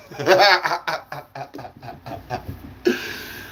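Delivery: long laugh at his own joke. Real, not acted. Comic laughs at his chocolate joke, real laughter. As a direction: crack up after the punchline.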